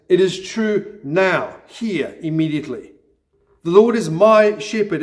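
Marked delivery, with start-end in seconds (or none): 3.6-5.0 "my" punched as the strongest word; stress on MY. Shown as a demonstration. Preacher showing that it's personal, stress on "my". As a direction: make it personal by leaning on "my". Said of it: "It's personal".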